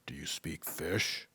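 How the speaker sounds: soft, unvoiced wise tree voice; tree whispering a question to the boy. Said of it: "the tree asked".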